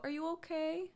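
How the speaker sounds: high, soft toddler voice, imitated; imitating toddler Clark. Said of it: "Clark will go".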